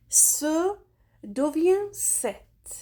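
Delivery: slow careful teaching. Shown as a demonstration. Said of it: "teacher stating the rule".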